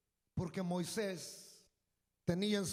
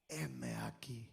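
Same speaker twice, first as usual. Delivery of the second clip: humble answer; 12 semitones lower, 8 dB quieter, much slower than usual. Acted. Moses answering God humbly.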